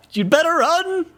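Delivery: hammed-up panicky warning from a would-be ghost; meek voice threatening. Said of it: "this meek voice".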